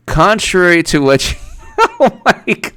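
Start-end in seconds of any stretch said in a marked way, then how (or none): 1.6-2.7 high laughing gasp of disbelief; giggling oh my God. Real, not acted. Laughing reaction.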